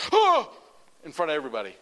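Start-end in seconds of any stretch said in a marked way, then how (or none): 0.1-0.5 very high, loud fake gasp; shrill hammed-up oh; mock gasp. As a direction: gasp in mock fear in front of everyone. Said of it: "he goes".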